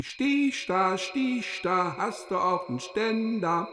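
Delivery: high teasing singsong; mocking taunt. Character's mocking chant.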